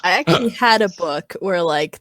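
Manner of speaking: ordinary delivery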